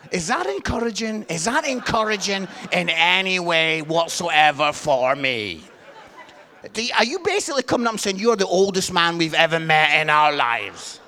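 loud comic outrage, sinking in pitch; mock indignation. Preacher aghast at being called old, mock indignation. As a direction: protest loudly, drawing out the complaint.

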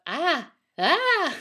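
high, loud mock screams of terror; terrified cry. A narrator's reading. Fleeing man at the soba seller's feet, crying out. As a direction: cry out in hammed-up fright. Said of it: "crying out".

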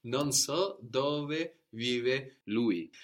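slow, careful model sentence; very slow teacher model. Shown as a demonstration. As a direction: say it slowly for the learner. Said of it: "Very good".

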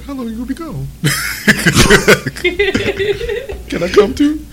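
small, high, quiet put-on voice; timid pleading. Partner begging to come to the store, small and high. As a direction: ask softly and high, hoping to tag along.

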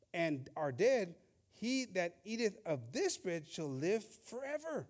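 slow, solemn, declaiming scripture; scripture reading. Acted. Preacher reading Jesus' words to the congregation, slow and solemn. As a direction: read each verse out slowly, weighing every word. Reads John 6 aloud.

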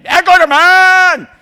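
loud, high, drawn-out holler; acted shout. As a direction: holler it out slowly at full volume. Acted outburst at grandson.

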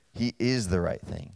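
soft repeat with IS held; "is" leaned on quietly again; stress on IS. Shown as a demonstration. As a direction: repeat it, pressing "is". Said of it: "that's what I'm talking about".

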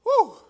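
high loud whoop of excitement. Real, not acted. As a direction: let out a joyful whoop. Preacher exclaims mid-sermon.

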